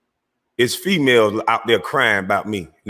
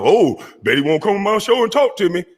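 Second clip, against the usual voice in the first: mock tough talk; 9 semitones higher than usual. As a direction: mimic him acting tough. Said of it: "act tough".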